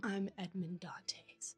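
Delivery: slow, hushed dramatic whisper; whispered reveal. Retold or imitated. The Count reveals himself to Ferdinand, whispering slowly. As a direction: let the secret out in a drawn-out whisper.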